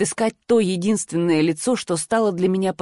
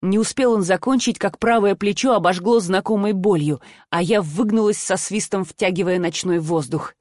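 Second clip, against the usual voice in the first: ordinary delivery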